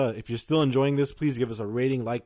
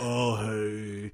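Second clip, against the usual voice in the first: personal voice; 3 semitones lower, 5 dB quieter than usual. Retold or imitated. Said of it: "there's a different voice when you're, like, talking to me personally".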